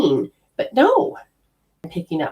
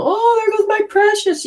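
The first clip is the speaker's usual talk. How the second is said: mock dismay. Imagining losing belongings.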